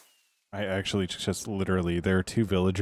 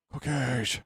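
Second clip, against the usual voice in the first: high, bright excited call; excited shout; 6 semitones higher than usual. Real, not acted. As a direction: blurt it out with delight. Excited about cookie stash.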